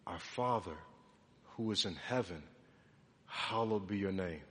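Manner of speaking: low, hushed recitation; reverent prayer. Acted. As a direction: pray it softly and reverently. Quietly reciting the Lord's Prayer.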